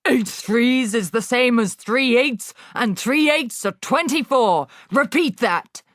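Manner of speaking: ranting headmistress. Acted. Headmistress berating Wilfred, loud and scornful. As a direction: sneer and hammer every insult.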